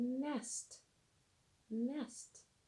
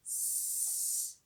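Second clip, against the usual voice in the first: long unvoiced hiss; snake hiss. Shown as a demonstration. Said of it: "The snake moves like this".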